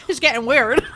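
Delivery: high, wobbly attempt at Australian; bad Australian accent. Shown as a demonstration. Host admits the attempt is going odd, bad Australian accent. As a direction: keep up a faltering Australian accent. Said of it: "talk to you in my Australian accent".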